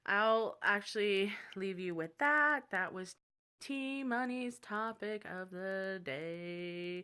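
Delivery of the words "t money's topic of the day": very slow, drawn-out title call; exaggerated slow-motion announcer sign-off; show sign-off. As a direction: stretch out each word like a show tagline.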